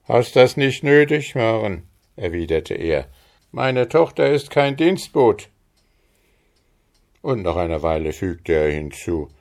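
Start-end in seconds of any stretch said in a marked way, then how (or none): 0.1-5.5 higher, a touch louder fatherly voice; a proud father brushing it off; lightly voiced father. A narrator's reading. Father tells Maren she need not work, lightly voiced.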